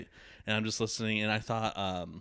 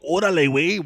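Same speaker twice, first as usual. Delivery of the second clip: loud, higher Mexican "Órale, güey"; Mexican slang call; 8 semitones higher, 10 dB louder than usual. Retold or imitated. Host mimicking how Mexicans greet you, loud Mexican call. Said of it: "if they go".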